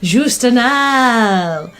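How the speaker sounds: very slow whispered-style brand name; sultry perfume ad. Pretend. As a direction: linger on the name. Mock perfume commercial closing.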